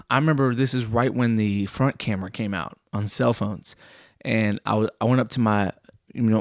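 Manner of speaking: ordinary delivery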